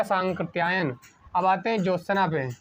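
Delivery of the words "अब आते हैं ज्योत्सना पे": ordinary delivery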